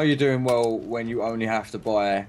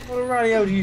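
put-on cockney accent; 11 semitones higher, much faster than usual. Continuing the cockney bit.

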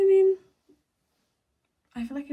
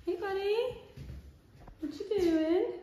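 higher sing-song voice for a dog; pet voice; 10 semitones higher, slower than usual. Real, not acted. Vlogger greeting her dog in a cooing pet voice. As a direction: coo at the pup.

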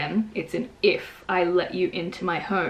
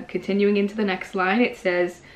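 usual speaking voice

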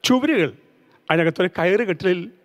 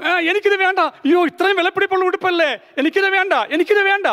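tearful refusal; 9 semitones higher than usual. A narrator's reading. Girl tearfully refuses costly dress.

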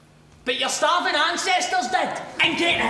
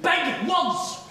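ordinary talk

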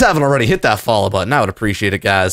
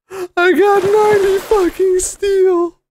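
much higher, ninety stressed; higher, more excited. Real, not acted. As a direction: shout the amount in disbelief. Repeats loot with more excitement.

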